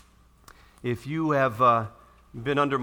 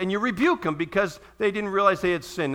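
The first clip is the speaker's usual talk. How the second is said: ordinary talk, nothing marked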